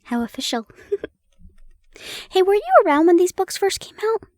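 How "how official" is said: plain reading voice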